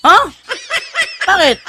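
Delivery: high, loud, laughing "Ha? Bakit?"; a laughing, defensive outburst; startled protest. Retold or imitated. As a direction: jump up in mock alarm. Atheist reacts to the question.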